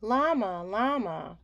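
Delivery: plain reading voice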